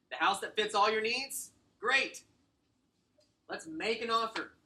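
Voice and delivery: upbeat sales pitch. Shown as a demonstration. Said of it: "sales script demo, upbeat pitch".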